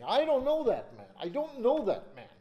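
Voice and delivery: loud, high desperate denial; Peter's denial. Acted. Preacher acting Peter cursing that he doesn't know Jesus, loud and high. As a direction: shout the denial as a cornered Peter.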